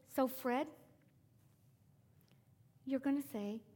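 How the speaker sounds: ordinary delivery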